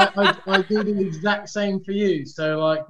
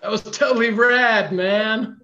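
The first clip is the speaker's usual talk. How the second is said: higher, louder mock surfer-dude Californian; California surfer accent; 3 semitones higher, 6 dB louder than usual. Shown as a demonstration. Performing California for friends on request, hammed-up surfer accent. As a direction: ham up a California surfer bro. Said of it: "let's hear the California".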